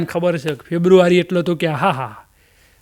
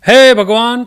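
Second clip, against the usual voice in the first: loud, drawn-out cry to God; a devotee's loud plea to God; calling to God; 4 semitones higher, 7 dB louder, slower than usual. Acted. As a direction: call out to the Lord, pleading.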